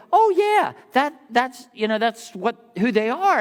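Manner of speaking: a playful aha voice; mock recognition. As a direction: act out the penny dropping. Imitating recognition.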